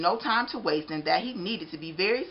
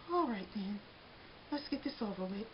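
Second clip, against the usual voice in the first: soft, defeated murmur; muttered; 4 semitones higher, 11 dB quieter than usual. A narrator's reading. Crushed Arnie gives up to Mister Bing, soft muttered surrender. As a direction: mutter it in resignation. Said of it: "and muttered".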